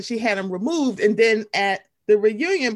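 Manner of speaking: ordinary talk, nothing marked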